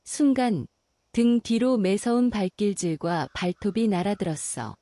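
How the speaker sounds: ordinary delivery, nothing marked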